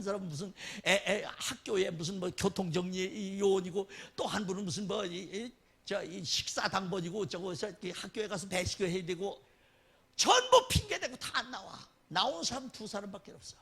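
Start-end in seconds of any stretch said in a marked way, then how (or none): none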